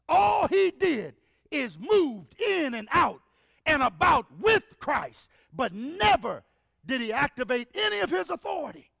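loud, driving sermon voice; preaching at full force. Acted. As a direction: preach it hard to the congregation. Preaching on Christ's surrender.

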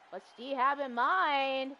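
ordinary delivery

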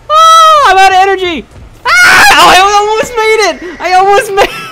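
high frantic screaming; scream into giggles. Real, not acted. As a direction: scream in panic, nearly there. Runs out of energy, screams.

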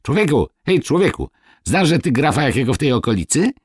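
high, quick, loud hail; an eager call to the farmer. A narrator's reading. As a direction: shout to the peasant eagerly. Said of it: "zawoła".

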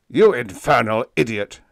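loud, angry roar; exasperated commander's outburst. A narrator's reading. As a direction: roar at the fool. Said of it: "roared the Venusian commander".

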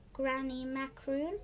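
usual speaking voice

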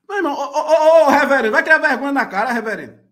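loud, fast scolding through laughter; half-laughing, half-shouting at the reverend; laughing outburst. Real, not acted. Reagindo indignado ao reverendo. (bronca alta entre risadas)

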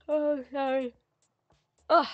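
talking through a big yawn; high, stretched words swallowed by a yawn; real yawn. Real, not acted. Player apologises to viewers mid-sentence, yawning hugely. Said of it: "big yawn".

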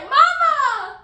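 high, full-volume joyful holler; imitated long-distance greeting yell; calling across field. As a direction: shout it to someone far away. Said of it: "across the field, he's like".

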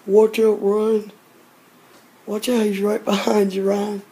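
warning shout. Real, not acted. Warning a friend in danger.

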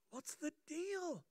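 lightly acted, timid asking of a cellmate; timid new prisoner. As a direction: ask it timidly, high and drawn out. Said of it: "timidly says to his cellmate".

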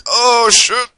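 high, loud startled curse; alarmed yelp. Real, not acted. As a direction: yelp as sudden danger hits. Sudden danger in the game.

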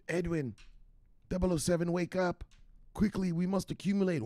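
high, hushed urgent voice; spy whispering to a sleeping partner to get up, high and hushed; urgent spy wake-up. Acted. Skit of waking sleeping agents.

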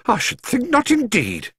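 high, indignant exclamation; exclaimed. A narrator's reading. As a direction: exclaim it in firm outrage. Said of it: "exclaimed Missus Bird".

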